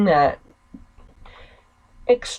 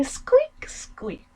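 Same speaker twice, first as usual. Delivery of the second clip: mock squeak; 13 semitones higher, 4 dB quieter than usual. Sister mocking her brother with rat squeaks, high. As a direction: squeak squeak, high then fading to a breath. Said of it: "Normally they just say".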